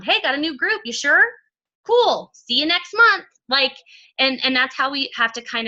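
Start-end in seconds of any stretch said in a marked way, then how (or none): 0.0-3.3 higher, louder breezy quote; breezy brush-off. Retold or imitated. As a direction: toss it off casually, pitched up. Acted quote of a casual recruit.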